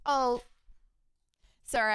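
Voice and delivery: usual speaking voice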